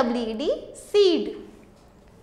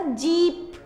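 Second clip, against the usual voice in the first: जीप with the ई held long; long vowel; 2 semitones higher, much slower than usual. Shown as a demonstration. Teacher showing children the long sound, जीप drawn out. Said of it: "सबसे long sound किसका आ रहा है".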